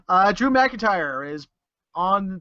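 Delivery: usual speaking voice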